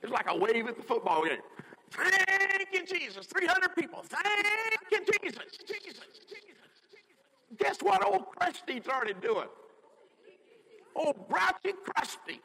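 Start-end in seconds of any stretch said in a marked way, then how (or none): 1.9-3.3 high thank-you echoed; crowd's praise. Retold or imitated. Crowd echoing mama's praise, high. 4.0-7.6 high cry fading to near silence; praise fading away. Retold or imitated. Crowd's praise dying away, high then fading.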